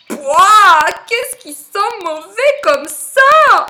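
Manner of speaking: high, loud, drawn-out disgusted outcry. Acted. Giraffe smells the dung-scented letter, loud disgust. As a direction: recoil from a stink, loudly and high.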